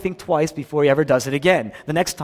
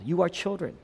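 ordinary talk

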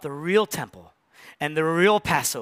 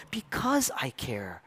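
whispered tenderness; 5 semitones lower, 6 dB quieter, slower than usual. Acted. Jesus voiced tenderly in sermon.

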